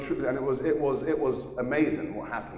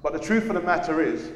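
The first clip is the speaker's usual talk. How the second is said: ordinary delivery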